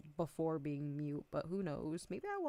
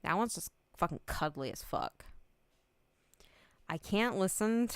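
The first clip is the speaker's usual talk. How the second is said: ordinary talk, nothing marked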